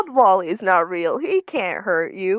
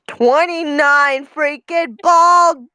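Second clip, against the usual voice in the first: outraged; 8 semitones higher, 3 dB louder, much slower than usual. Real, not acted. Outraged at the emotes.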